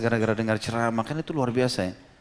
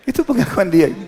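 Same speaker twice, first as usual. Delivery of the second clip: laughing; 9 semitones higher, 7 dB louder than usual. Real, not acted. Speaker laughs at the story.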